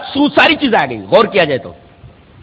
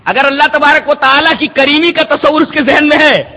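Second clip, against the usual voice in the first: normal talking voice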